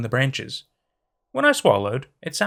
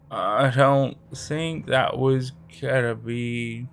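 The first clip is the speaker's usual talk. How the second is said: dazed cracked voice; much slower than usual. Acted. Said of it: "His voice still cracked".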